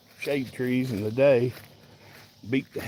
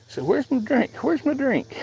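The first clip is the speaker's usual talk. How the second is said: much higher pretend dog voice; voicing the dog; 11 semitones higher than usual. Owner speaking for Max asking for water, much higher pretend voice. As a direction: whine it like a thirsty pup.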